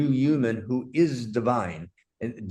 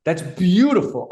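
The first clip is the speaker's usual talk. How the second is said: loud, high, amazed praise; thrilled exclamation; real delight; 7 semitones higher, 7 dB louder than usual. Real, not acted. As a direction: marvel out loud at the idea. Delighted by co-host's insight.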